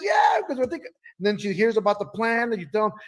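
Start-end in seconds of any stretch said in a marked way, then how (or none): 0.0-0.9 high excited yell; excited shout. Retold or imitated. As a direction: call out pumped up. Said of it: "She goes".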